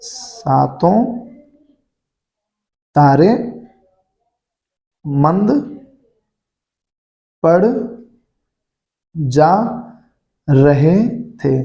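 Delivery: each word alone, long gaps, very slow; slow dictation. Shown as a demonstration. Teacher dictating the corrected sentence, very slow word by word. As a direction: spell it out for the class to copy down. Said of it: "तो ये कैसे बन जाएगा बेटा?".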